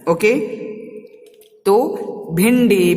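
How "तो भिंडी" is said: भिंडी stretched very slowly, nasal n clear; slow clear demo. Shown as a demonstration. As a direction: sound out भिंडी for the class. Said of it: "भिंडी में भी भिन्न".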